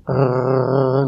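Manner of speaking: a drawn-out "arr" like a growling dog. Retold or imitated. Dog warning a man reaching to pet it, growling. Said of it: "the dog starts going".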